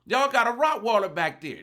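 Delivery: loud, high warning call. Retold or imitated. Warning her loudly that a Rottweiler is out back. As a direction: shout it through the house. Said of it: "I say".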